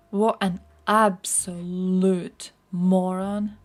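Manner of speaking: disgusted slow emphasis. Real, not acted. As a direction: spell out his disgust word by word. Furious about Musk's Starlink decision.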